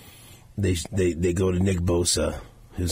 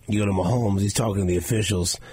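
ordinary talk, nothing marked

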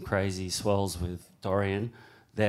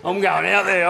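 louder, higher defiant shout; defiant yell; 7 semitones higher, 4 dB louder than usual. Retold or imitated. Surfer yelling at the lawyers that he's going out, defiant. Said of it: "and I'm like".

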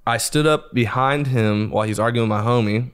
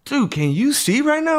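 much higher, alarmed question; alarmed cousin; 4 dB louder than usual. Retold or imitated. Cousin checks if he can see, high and alarmed. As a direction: ask in pure alarm.

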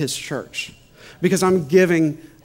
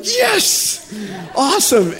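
much higher, laughing mock delight; thrilled husband hearing of free shoes, laughing; delighted yes; 9 semitones higher, 4 dB louder, much slower than usual. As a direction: squeal the good news through a laugh. Reacting to wife's text news.

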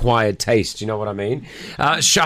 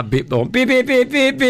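car beep imitation; 10 semitones higher than usual. Mimics car unlocking sounds.

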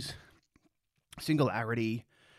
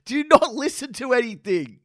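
incredulous outburst; 9 semitones higher than usual. Real, not acted. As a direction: blow up in disbelief at the claim. Incredulous outburst at Finn's claim.

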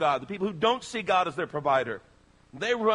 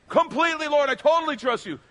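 high, loud, eager promise; eager insistence; 10 semitones higher, 9 dB louder than usual. Retold or imitated. Man on the cliff assuring the Lord, high and eager. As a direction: swear you trust him completely.

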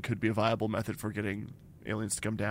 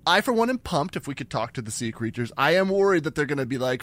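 ordinary delivery

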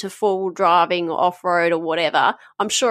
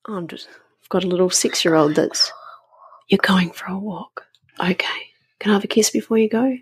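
whisper. Real, not acted. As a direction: whisper so the recording isn't disturbed. Whispering to her child mid-podcast.

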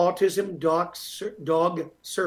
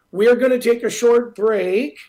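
announcer; 6 semitones higher, 9 dB louder than usual. Acted. Radio host break announcement.

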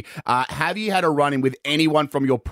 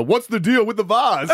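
slow, higher stand-up observational tone; Jerry Seinfeld; 8 semitones higher, slower than usual. Pretend. Co-host picturing Jerry critiquing the vase, mock Seinfeld. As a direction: lightly do the comedian's signature bit. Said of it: "I can just imagine it with a".

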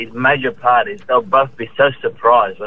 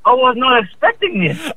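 mock gasp of surprise, pitched up; fake surprise; 11 semitones higher than usual. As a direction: act surprised at a bad present. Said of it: "You're like".

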